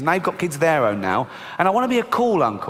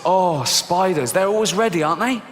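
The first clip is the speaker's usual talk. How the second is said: ordinary talk, nothing marked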